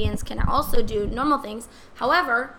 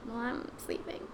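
fast whispered aside; 12 dB quieter than usual. Real, not acted. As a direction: whisper it to the side. Whispered joke.

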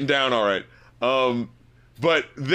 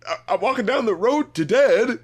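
imitating the singer; 5 semitones higher than usual. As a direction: incredulously mimic the odd phrasing. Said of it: "Why is she speaking like this?".

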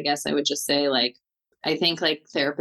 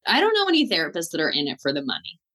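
ordinary delivery

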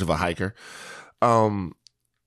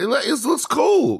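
excited; 14 semitones higher, slower than usual. Real, not acted. Hypes up cohost's look.

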